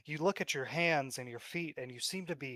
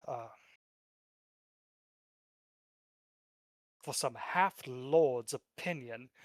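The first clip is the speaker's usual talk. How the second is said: arrogant; 3 dB louder than usual. Acted. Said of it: "arrogantly says".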